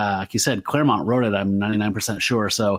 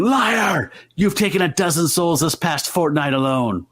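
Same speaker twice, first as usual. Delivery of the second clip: mock shout of liar; 5 semitones higher than usual. Reader voicing the brother accusing Satana, mock yelling. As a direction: accuse her with a hammed-up yell. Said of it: "this great panel of him going".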